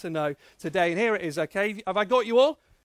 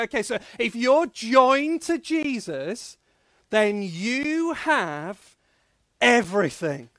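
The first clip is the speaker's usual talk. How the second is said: slow build, pause, then EVERYTHING loud; call-and-response line; 5 dB louder than usual. Shown as a demonstration. Preacher leading the children in a chant, slow then loud. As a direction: hold it, pause, punch everything. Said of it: "one more time".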